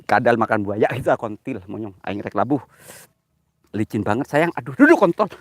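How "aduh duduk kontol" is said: sudden high loud yelp; startled yelp. Real, not acted. Slipping on a muddy slope, startled yelp. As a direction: yelp as the foot slips.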